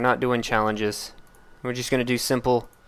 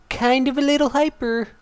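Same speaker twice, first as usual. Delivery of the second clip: hyper; 13 semitones higher than usual. Real, not acted. Says he is hyper from Red Bull.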